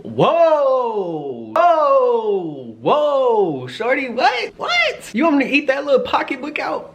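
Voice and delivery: loud, high, long exclamations; hammed-up high loud disbelief; stunned whoa. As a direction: cry out in total shock. Reacting to her sexual offer.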